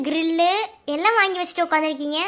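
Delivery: usual speaking voice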